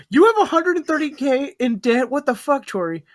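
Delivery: high, loud disbelief; shocked. Real, not acted. Shocked at hundred thirty K debt.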